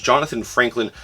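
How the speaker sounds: normal talking voice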